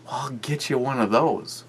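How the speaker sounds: a hint of her high voice; imitating a friend. Friend's reply acted out.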